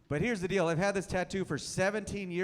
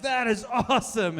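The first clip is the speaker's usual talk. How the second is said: laughing delight; 6 semitones higher, 6 dB louder than usual. Retold or imitated. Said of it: "I was like".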